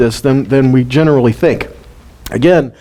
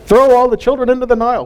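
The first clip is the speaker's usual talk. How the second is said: higher, fast mock royal command; Pharaoh's decree; 8 semitones higher, faster than usual. Preacher voicing Pharaoh's order to his people, mock command. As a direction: bark it out like a tyrant king, lightly. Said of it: "that Pharaoh pronounced".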